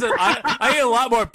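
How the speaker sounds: laughing. Retold or imitated. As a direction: repeat it while cracking up.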